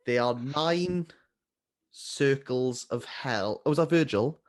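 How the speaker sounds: ordinary talk, nothing marked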